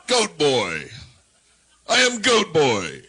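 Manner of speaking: loud, slow mock-mythic creature voice; hammed-up goat boy proclamation; booming goat boy. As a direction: announce yourself as a randy goat god. Stage persona bit, booming.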